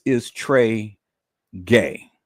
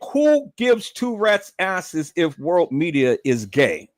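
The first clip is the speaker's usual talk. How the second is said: ordinary talk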